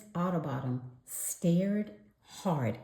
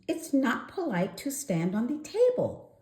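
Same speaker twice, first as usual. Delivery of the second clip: librarian voice; 8 semitones higher than usual. A narrator's reading. Miss Otterbottom tells Froggy to sit down, prim high voice. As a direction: gently scold like a prim librarian. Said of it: "said Miss Otterbottom".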